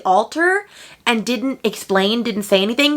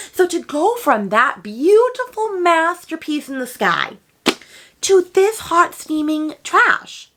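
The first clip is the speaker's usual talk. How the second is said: higher, slow and theatrical, masterpiece to trash; dramatic mockery; 7 semitones higher, slower than usual. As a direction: ham up the contrast, dragging it out slowly. Dramatic contrast, masterpiece versus trash.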